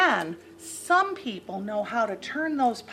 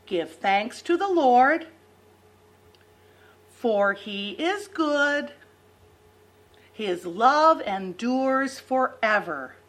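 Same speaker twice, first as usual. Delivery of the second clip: put-on broad singsong kids theatre; slower than usual. Shown as a demonstration. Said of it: "Can you say that with me".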